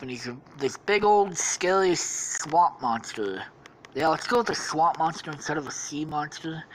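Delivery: ordinary talk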